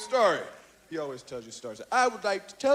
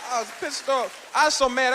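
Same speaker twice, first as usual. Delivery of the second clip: usual speaking voice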